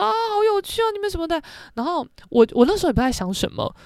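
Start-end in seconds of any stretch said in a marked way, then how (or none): 0.0-1.4 much higher, cooing praise from strangers; lightly voiced gushing fan; mock gushing. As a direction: gush like a delighted bystander. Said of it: "就说".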